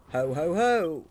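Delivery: booming king's ho ho ho; jolly royal laugh. Acted. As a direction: laugh like a merry old king. King character laughs.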